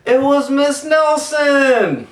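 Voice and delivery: high, loud, slow joyful cry; delighted shout. A narrator's reading. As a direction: cry it out like thrilled children.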